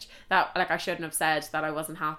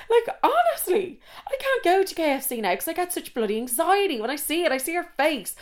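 exasperated rant; 9 semitones higher, 3 dB louder than usual. Real, not acted. Ranting about anxiety from betrayal.

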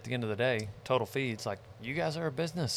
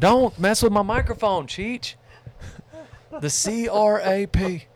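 mock scolding; 7 semitones higher, 7 dB louder, slower than usual. Mock scolding over microphone.

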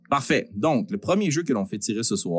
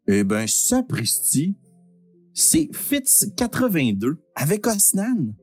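booming prize announcer; 9 semitones higher, slower than usual. Acted.

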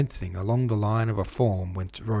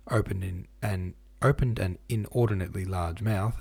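ordinary delivery, nothing marked

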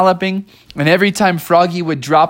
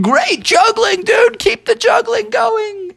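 high, slow shout from afar; calling out; slower than usual. Acted. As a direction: yell back while running away. Moose calls back while galloping off.

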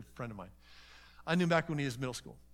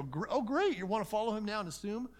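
fake enthusiasm; 7 semitones higher, 3 dB louder than usual. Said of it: "I don't wanna just go".

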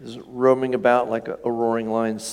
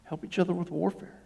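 soft urging; 6 semitones higher, 8 dB quieter than usual. Retold or imitated. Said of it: "He's just saying".

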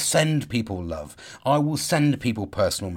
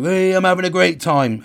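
mock cheerful; 6 semitones higher, 5 dB louder than usual. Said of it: "And people say to me".

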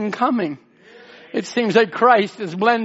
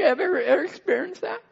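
choked up; 9 semitones higher than usual. Real, not acted. Preacher choking up mid-sermon.

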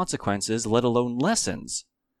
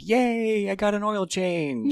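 much higher, chirpy proud cheer; mock pride; 11 semitones higher, 4 dB louder, slower than usual. Celebrating a small adult chore, a high proud cheer. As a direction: squeal it like a big win. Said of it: "You're like".

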